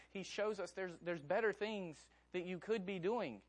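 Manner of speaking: ordinary talk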